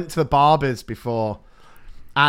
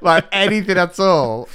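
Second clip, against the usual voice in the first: ordinary delivery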